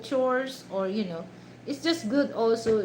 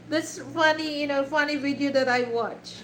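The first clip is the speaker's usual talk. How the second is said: ordinary delivery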